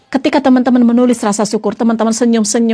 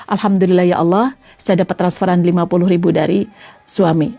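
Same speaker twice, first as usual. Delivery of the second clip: grateful prayer; 7 semitones lower than usual. Shown as a demonstration. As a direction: murmur it humbly to God. Wife thanking God for transfer.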